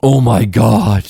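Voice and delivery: mock horror. Mock outrage at a kiss.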